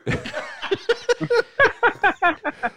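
high cackling laugh. Real, not acted. Laughs at the old-man bit.

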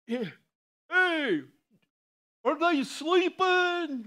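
high, loud, slow taunting call; mocking Elijah. Elijah loudly taunts the prophets of Baal. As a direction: taunt the rival prophets from the hilltop.

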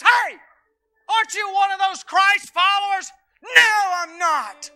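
loud, high, driving sermon delivery; preaching at full force. Acted. Pastor to congregation, preaching hard and loud.

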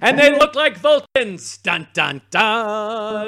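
loud, high announcement into a hammed-up suspense sting; big mock-shock reveal, dun-dun-dun; mock dramatic reveal. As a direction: play it like a cheesy cliffhanger.

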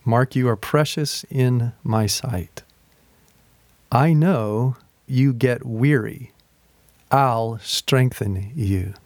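slow, deliberate read aloud; slow, soaking reading. Shown as a demonstration. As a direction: let each word sink in slowly. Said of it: "I read it slow like I did to you".

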